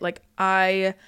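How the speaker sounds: ordinary delivery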